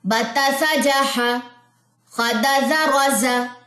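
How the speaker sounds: slow, sing-song syllables; rhythmic reading. Shown as a demonstration. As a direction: read it in one steady rhythm. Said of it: "एक साथ combine करते हैं".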